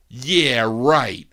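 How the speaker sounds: loud, drawn-out dismissive mimicry; disrespectful. Father brushing off his son's consoling, mimicked as disrespectful. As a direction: imitate a scornful brush-off. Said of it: "That's not how you say it".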